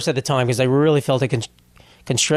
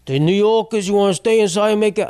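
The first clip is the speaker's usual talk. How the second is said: higher put-on mayor voice, chirpy; Eric Adams impression; 6 semitones higher than usual. Mayor on TV urging New Yorkers to stay in, put-on higher voice. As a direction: mimic the mayor selling a snow day, pitched up. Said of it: "I can't do an Eric Adams impression".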